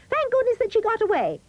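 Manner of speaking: high, quick and cheering; children's voices. A narrator's reading. Children celebrating Goldie's escape, high fast cheer. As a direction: gush it like relieved little kids. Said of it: "they say".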